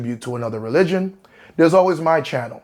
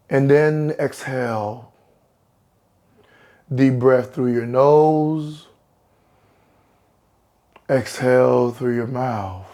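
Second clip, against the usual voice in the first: guided meditation. Acted. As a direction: ease them down slowly, breath by breath. Leads a guided relaxation.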